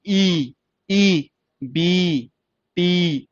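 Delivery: ई held long, also in बी and टी; long vowel. Shown as a demonstration. Teacher demonstrating big ई, long vowel. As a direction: stretch each ई sound separately. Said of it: "ये बड़ी ई की sound".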